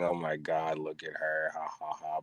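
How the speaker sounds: ordinary delivery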